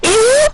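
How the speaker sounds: very high, loud squeal; weird mic noise. Retold or imitated. Players celebrate a kill on mic, weird squealed noise. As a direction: let out a silly piercing shriek. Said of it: "making weird noises in the mic".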